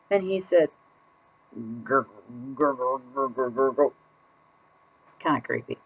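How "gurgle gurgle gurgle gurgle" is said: low, soft bubbly gurgle; fish gurgling. Acted. Silent fish answers with gurgles.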